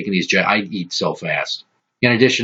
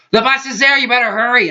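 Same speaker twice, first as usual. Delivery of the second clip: much higher, urgent mother call; mock panicky mom. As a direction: call from the kitchen, high and alarming. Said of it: "She goes back in the kitchen".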